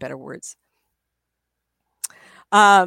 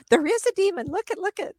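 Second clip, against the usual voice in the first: mock panic; 12 semitones higher than usual. Mimicking frightened investigators.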